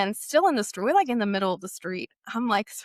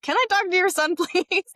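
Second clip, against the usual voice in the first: high, half-laughing mock desperation; crazed request; 9 semitones higher, 4 dB louder than usual. Frantic girl asking the boy's mom, crazed request. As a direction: imitate yourself asking frantically, pitched way up. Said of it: "I'm sure I looked crazy".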